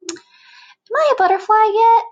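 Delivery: put-on high, loud eager kid voice; a fidgety bug asking too soon; squeaky demanding caterpillar; impatient caterpillar.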